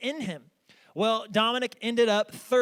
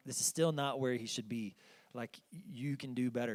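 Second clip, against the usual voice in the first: inner thought; 8 semitones lower, 10 dB quieter than usual. Retold or imitated. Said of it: "and I'm like".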